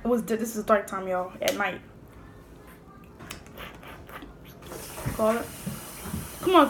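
speaking between bites; slight food in mouth; talking while eating crab. Real, not acted. Seen 4: Handles crab throughout, hand to mouth at 0.8, chewing between phrases.

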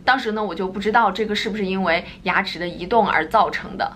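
ordinary delivery

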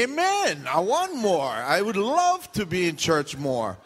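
high amen, then drawn-out eagerness; hammed-up church enthusiasm; enthusiastic acclaim. Models enthusiastic congregation reply.

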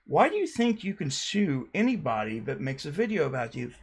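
ordinary delivery

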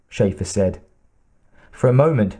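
ordinary delivery, nothing marked

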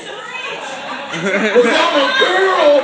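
put-on slow, drawn-out helpless girl voice; mock girly plea. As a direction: stretch it out like a fake victim. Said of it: "when you punch them in the fucking face".

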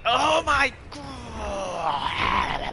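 very slow loud oh my god; drawn-out shout. Real, not acted. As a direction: stretch out the shock. Grenade shock.

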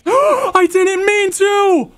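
gasp, then high, stretched shriek; real high-pitched scream of alarm; panicked shout. Real, not acted. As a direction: wail it high in horrified apology. Accidentally hits villager in game.

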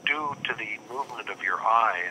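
ordinary talk, nothing marked

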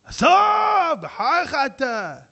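high, loud, wailing shout that slows down; angry shout. Acted. Congregant shouting at the rabbi in protest, angry wail. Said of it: "על מי אתה צועק?".

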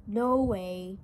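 usual speaking voice

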